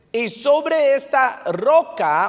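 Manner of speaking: SOBRE pushed high and loud, very slow; emphatic scripture quote. Retold or imitated. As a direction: stretch out "sobre esta roca". Preacher quoting the verse emphatically.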